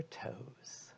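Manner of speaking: breathy whisper trailing off. Acted. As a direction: let it fade into a whisper. Meditation cue trails to whisper.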